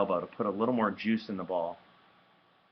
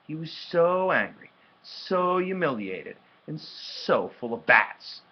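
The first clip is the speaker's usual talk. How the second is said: "so" stretched long and high each time; slow build, leaning hard on every "so"; emphatic stress on "so"; 8 semitones higher, 4 dB louder, slower than usual. A narrator's reading. Narrator piling up Evan's anger, emphatic "so". Narrator heaps up the boy's feelings.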